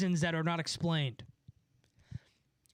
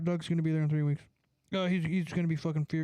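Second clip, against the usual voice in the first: usual speaking voice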